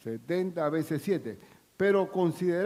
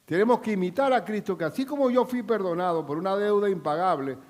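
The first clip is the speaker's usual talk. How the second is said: loud, rising sermon proclamation; fervent preacher at the climax; full-force preaching; 4 semitones higher, 7 dB louder than usual. Acted. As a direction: drive it home to the congregation.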